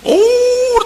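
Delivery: very high, long mock wail; drawn-out high "oh" of crying; imitated wail. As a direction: imitate a grown man bawling.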